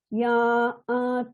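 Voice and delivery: Ya at chanted in two notes, ya then a; split syllable. Shown as a demonstration. Teacher isolating the split syllable, chanted. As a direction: sing ya, then glide into at. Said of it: "the syllable ya is split into ya a".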